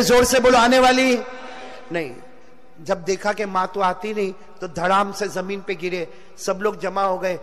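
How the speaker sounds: ordinary talk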